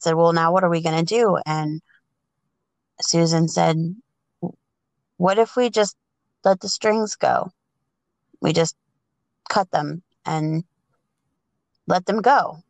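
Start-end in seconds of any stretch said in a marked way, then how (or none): none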